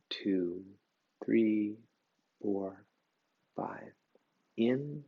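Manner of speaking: slow, soft breathing count; hushed yoga teacher pacing inhale and exhale; breath-count guidance. Shown as a demonstration. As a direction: count the breaths out gently with long gaps. Counts the breath cycle aloud.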